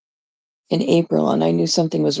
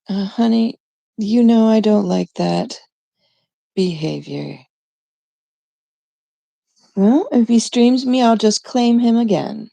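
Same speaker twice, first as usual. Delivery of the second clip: mock scold to chat; 6 semitones higher than usual. Real, not acted. Scolding a chatter on stream.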